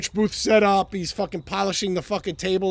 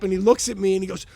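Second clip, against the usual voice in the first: ordinary delivery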